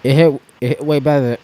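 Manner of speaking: talking while choking on peanuts; words strained by a caught peanut; choking on food. Real, not acted. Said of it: "God, I'm choking on the peanut snack".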